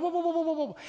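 high, rapid run of whoas; halting whoas. Acted. Preacher stopping the complainer, urgent halting. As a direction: cut them off with urgent whoas.

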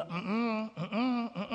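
soft whiny "mm-mm"; reluctant refusal. Acted. Believer turns down God's nudge, small reluctant grunts. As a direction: hum a quiet, childlike no.

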